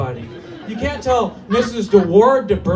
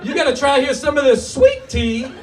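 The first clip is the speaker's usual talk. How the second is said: put-on Southern general accent; 5 semitones higher than usual. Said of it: "a Southern general ... he says".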